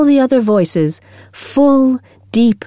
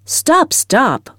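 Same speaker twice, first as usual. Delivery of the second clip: higher urgent cry to halt the singing; cried out; 5 semitones higher than usual. A narrator's reading. Music teacher stopping the girls' singing, higher sharp cry. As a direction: cut the choir off sharply. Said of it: "cried Miss Meadows".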